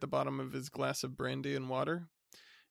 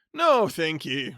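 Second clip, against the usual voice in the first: top of his voice; 9 dB louder, slower than usual. Acted. Hearty cousin greeting the bachelor at the top of his voice. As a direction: boom it out like a jovial bore. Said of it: "barbarous indifference".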